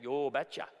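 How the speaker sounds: light Minnesota Norwegian "Yo betcha"; a mild Norwegian dad accent, said "yo betcha". Retold or imitated. Norwegian father answering the suitor, mild Norwegian accent. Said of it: "in his thick Norwegian accent".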